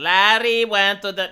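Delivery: measured classroom model for pupils; teacher's model. Acted. Teacher giving children a line to repeat, measured model delivery. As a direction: say it for the class to copy. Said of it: "Repeat after me".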